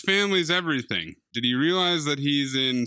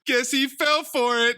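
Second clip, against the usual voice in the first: pitched-up mock LeBron; 9 semitones higher than usual. Friend voices LeBron being fooled, pitched-up mock. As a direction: play the duped LeBron lightly. Voicing LeBron falling for trick.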